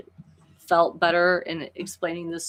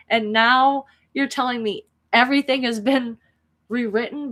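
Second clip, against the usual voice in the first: anguished protest; 6 semitones higher, 3 dB louder than usual. Real, not acted. Fan objects to characters forgetting everything, anguished protest. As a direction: wail your objection, starting loud and high.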